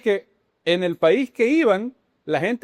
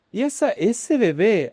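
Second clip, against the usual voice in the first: put-on slow, higher sing-song Argentine child; little girl's Argentine accent; 4 semitones higher, slower than usual. Small niece asking about a baby, imitated Argentine accent. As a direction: imitate a child's lilting Italian-tinged Argentine Spanish. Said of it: "así habla la niña".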